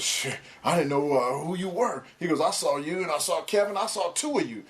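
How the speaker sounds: a hint of Pac's sheepish explanation; imitating Pac. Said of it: "He goes, he goes".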